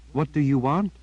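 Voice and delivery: Jillian, puzzled. A narrator's reading. Girl asking her raven, lightly voiced puzzlement. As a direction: ask the bird what it's up to, lightly voiced.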